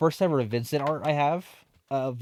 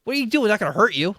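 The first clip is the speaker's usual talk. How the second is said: much higher, louder mock indignation; pushy customer; 7 semitones higher, 4 dB louder, much faster than usual. Customer scolding the cashier for backing away, indignant. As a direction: scoff at her flinching. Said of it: "And he was like".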